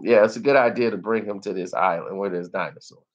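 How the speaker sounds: ordinary delivery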